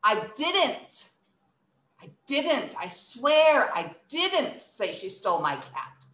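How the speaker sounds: "didn't" punched each time; insistent denial. Shown as a demonstration. Someone thinks I said that, insistent denial. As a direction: swear it, leaning on "didn't". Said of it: "someone thinks I said that".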